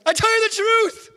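high, loud emotional outburst; exclaimed outburst. Acted. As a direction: blurt it out, unable to hold it in. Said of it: "blurts it out".

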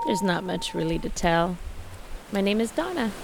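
weak, fading voice; strained voice giving out; voice going. Real, not acted. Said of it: "my voice is going".

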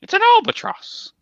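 very high screechy squawk; shrill falsetto bird voice; albatross cry. Acted. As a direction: squeal it out like a silly seabird. Mock squawking bird quote.

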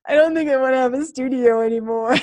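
admitting it with a laugh breaking through; laughing confession. Retold or imitated. Telling her coach she wants out, laughing. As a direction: let the laugh spill over the words.

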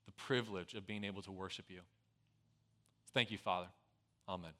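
low, soft prayer voice; hushed pastoral prayer. Acted. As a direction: pray quietly and earnestly to God. Leads a prayer.

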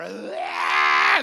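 loud mock scream of frightened kids; hammed-up child scream; kids' scream. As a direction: belt out a kid's terrified scream. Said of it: "They're screaming".

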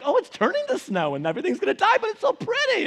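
husband mimicking wife's joy at snow; imitating his wife. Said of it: "my voice for her".

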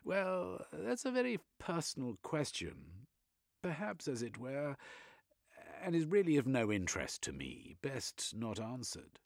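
a stiff Brit dodging the question; prim British gentleman. Acted. Velveteen, prim British figure.

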